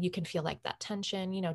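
ordinary talk, nothing marked

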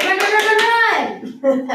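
high, loud, rapid-fire shouts; urgent urging. Acted. Teacher clapping at children who just sat, urgently shouting. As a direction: drive them on with repeated cries. Urges seated children to run.

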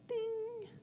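high, light "ding" of a magic moment; high soft ding. Retold or imitated. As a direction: go "ding" like a tiny magic bell. Imitates the magic head pat chime.

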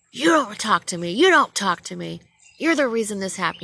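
loud, high-pitched telling-off; yelling officer. Retold or imitated. Rookie cop silencing the driver, yelling. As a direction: imitate an angry cop shutting someone down. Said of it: "she was yelling at me".

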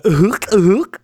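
guttural Swiss throat sound; a hint of Swiss German hürk. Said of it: "Schweizerisch zu reden".

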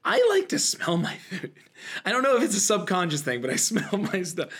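ordinary talk

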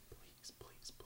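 tiny, barely audible begging whisper; whispered pleading. Retold or imitated. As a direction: mouth it softly, imploring. Mimes character pointing and begging.

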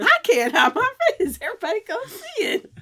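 high, loud, laughing self-parody; mock panic. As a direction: ham up the camera panic, voice climbing. Hamming up own quoted panic.

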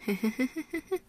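awkward laugh. Real, not acted. After saying Mom and Dad might be listening, awkward laugh. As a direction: let out a small, uncomfortable giggle. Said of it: "the most awkward laugh".

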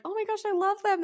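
gushing delight. Retold or imitated. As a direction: squeal it like a new fan. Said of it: "you're like".

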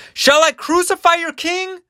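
very high, strained last appeal; loud, high-pitched incredulous question; imploring Pilate. Acted. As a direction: ask the mob one last time, voice way up. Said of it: "And Pilate, just one more time".

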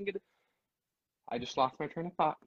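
ordinary delivery

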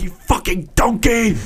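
high, put-on exasperated chef insult; Gordon Ramsay. Podcaster imitating the uncensored TV chef, exasperated insult. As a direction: spit the insult as a mock scolding. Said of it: "uncensored Gordon Ramsay".

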